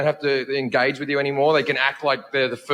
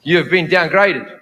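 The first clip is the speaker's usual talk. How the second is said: mimicking clerk; 7 dB louder than usual. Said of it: "She goes".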